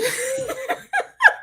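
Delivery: embarrassed high-pitched laugh. Real, not acted. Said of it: "I'm stuttering".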